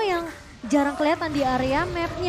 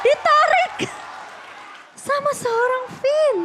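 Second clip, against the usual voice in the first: much higher, loud, then slow; excited shout; 10 semitones higher, 5 dB louder, slower than usual. Real, not acted. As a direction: shout in disbelief. Analyst reacts to surprise play.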